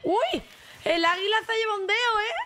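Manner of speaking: high, sudden surprised cry; real startled squeal; startled yelp. Real, not acted. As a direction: jump at the shock. Eagle bites finger, startled.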